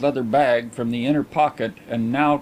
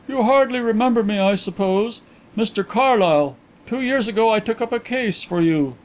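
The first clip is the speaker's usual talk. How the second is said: a lightly raised, eager detective voice; the inquiry agent reintroducing himself, keen and higher; Mister Carlyle voiced; 9 semitones higher than usual. A narrator's reading. Detective greeting the coin dealer, lightly voiced higher.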